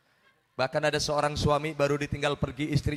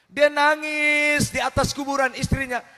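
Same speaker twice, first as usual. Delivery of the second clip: louder, higher, half-sung repeat; loud emphatic retelling; 7 semitones higher, 6 dB louder than usual. Shown as a demonstration. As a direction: belt it out for the crowd. (diulang lebih keras dan tinggi)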